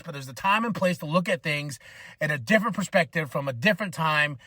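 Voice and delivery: ordinary talk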